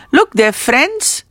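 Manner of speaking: slow down and lift the word friends; stress on FRIENDS. Shown as a demonstration. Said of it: "Here the emphasis is on friends".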